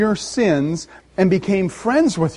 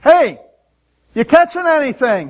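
playful yell; 8 semitones higher, 5 dB louder than usual. Retold or imitated. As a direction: friendly call across the water, higher and louder. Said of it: "he yells out to the boat".

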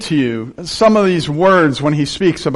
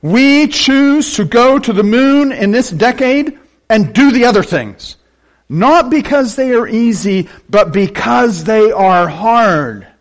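slow, ringing proclamation, strongly projected; quoting presidential oratory; 5 semitones higher, slower than usual. Retold or imitated. Preacher delivers the president's moon pledge to listeners, rousing slow oratory. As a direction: declaim it deliberately, stretching the final phrase. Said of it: "he says it a third time".

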